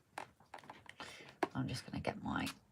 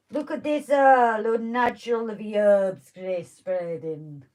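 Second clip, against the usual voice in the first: put-on a light, clumsy Jamaican accent; bad Jamaican accent; slower than usual. For the viewers, a lightly done Jamaican accent. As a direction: show off the herbs in a mock Jamaican accent. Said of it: "That terrible accent you're attempting".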